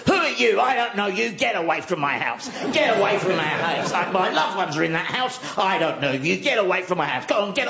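high, frantic yelling at a passerby; yapping guard dog as a man. Retold or imitated. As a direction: bark like a territorial dog in human words. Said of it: "jumping at the gate going".

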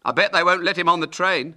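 put-on high, loud boy's voice; teasing schoolboy. A narrator's reading. Ginger chimes in.

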